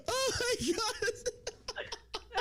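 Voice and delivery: high, helpless real laughter through the words; cracking up. Real, not acted. Host cracking up at guest's candor, laughing hard.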